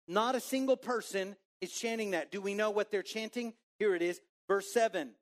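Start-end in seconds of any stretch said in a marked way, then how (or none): none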